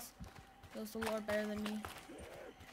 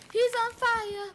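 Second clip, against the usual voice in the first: much higher and louder, thrilled; excited shout; 10 semitones higher, 8 dB louder, slower than usual. Real, not acted. Gamer shouting to viewers about the burning zombie, excited yell.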